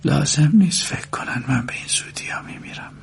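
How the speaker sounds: hushed, half-whispered muttering; under the breath. A narrator's reading. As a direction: mutter softly to yourself. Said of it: "زیر لب گفت".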